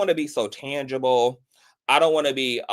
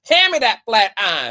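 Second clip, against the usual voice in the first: louder repeat; 9 semitones higher, 7 dB louder than usual. Shown as a demonstration. Demanding the flat iron from the chat, louder and sassier. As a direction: Hand me that flat eye, higher and louder.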